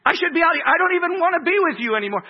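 shouted, high and fast, a spouse lashing out; an imitated spouse yelling in a marital fight; mock angry spouse. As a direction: yell it like a bitter spouse mid-fight.

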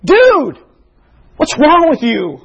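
high, exasperated call of disbelief; the people of Nineveh call out to this generation in disbelief; incredulous Ninevite. Retold or imitated. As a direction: throw it at them like a shocked onlooker. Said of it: "are looking over and saying".

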